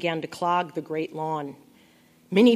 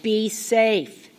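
raised, louder parting call; yelling; 4 semitones higher, 5 dB louder than usual. A narrator's reading. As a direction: call it after someone leaving. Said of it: "I yelled".

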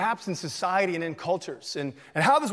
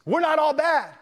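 defensive; 6 semitones higher than usual. Retold or imitated. Officer defends himself, retold.

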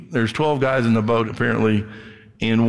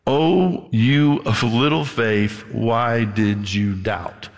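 slow, drawn-out reproach; solemn rebuke; 2 semitones lower, much slower than usual. Acted. Jesus to sinking Peter, slow solemn rebuke.

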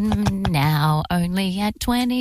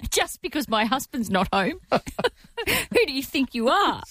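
laughing mock outrage; 6 semitones higher than usual. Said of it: "mock indignant homeowner".

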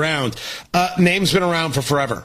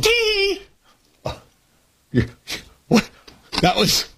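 ice in mouth. Real, not acted. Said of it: "I had a piece of ice in my mouth".

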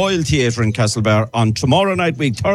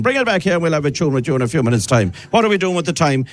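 normal talking voice

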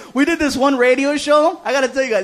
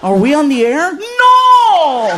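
over-hyped radio announcer; 4 semitones higher, 6 dB louder, much slower than usual. Retold or imitated. DJ still booming off the air.

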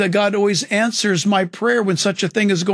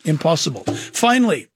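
ordinary delivery

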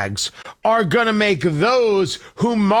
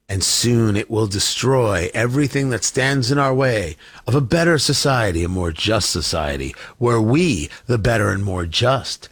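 sinister villain voice; 9 semitones lower than usual. Retold or imitated. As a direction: purr it like a cartoon overlord plotting. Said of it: "And they talk like that".

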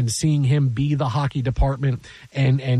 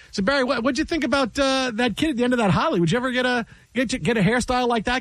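teasing anchor voice; 7 semitones higher than usual. Retold or imitated. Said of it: "Bill says".

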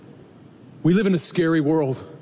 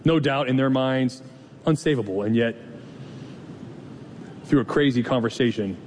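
closing prayer. Acted. Pastor praying for the congregation, hushed and reverent.

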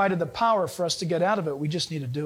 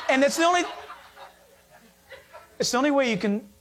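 higher, breaking up with laughter; laughing; 4 semitones higher, faster than usual. Real, not acted. Preacher joking about lying people on the news, laughing. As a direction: crack up while getting the words out. Cracks up mocking lying newscasters.

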